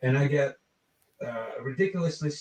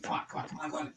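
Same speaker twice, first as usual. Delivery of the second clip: urgent whisper; 12 dB quieter, much faster than usual. Retold or imitated. Urging him backstage quietly.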